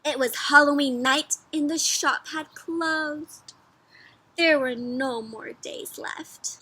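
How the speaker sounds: put-on slow, gentle read-aloud to little kids; storybook reading. A narrator's reading. Teacher reading the story to her class, slow storybook voice. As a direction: read it like a bedtime picture book.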